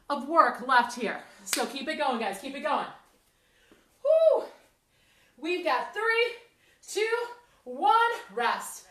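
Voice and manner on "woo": high, loud "woo"; exertion whoop. Real, not acted. Instructor spurring the class on through the last minute, high loud whoop. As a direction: let out a loud workout whoop.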